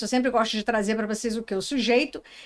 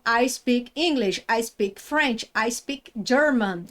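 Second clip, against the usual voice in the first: each phrase spoken slowly and clearly; a teacher modelling sentences for students to repeat; slow English model sentences; slower than usual. Shown as a demonstration. Teacher reads examples slowly to the class. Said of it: "você usa speak".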